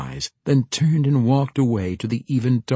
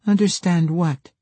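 put-on high-pitched girl's question; puzzled girl; 10 semitones higher, 4 dB louder than usual. A narrator's reading. Girl asking Jacka what he means, puzzled. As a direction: ask it high and curious.

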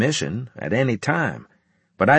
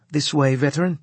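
mechanical voice; 6 semitones higher than usual. A narrator's reading. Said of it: "in an almost mechanical voice".

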